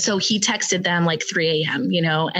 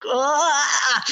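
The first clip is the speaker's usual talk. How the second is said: mock moan; 4 semitones higher than usual. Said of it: "just like".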